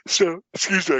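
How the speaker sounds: yawning through the words. Real, not acted. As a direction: talk mid-yawn, pitch jumping up. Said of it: "I keep yawning".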